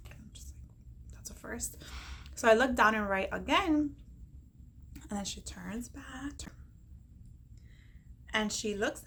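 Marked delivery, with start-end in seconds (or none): 0.1-1.8 whisper. Real, not acted. Whispered aside at the doctor. 5.1-6.5 whisper. Real, not acted. Whispered narration of suspense.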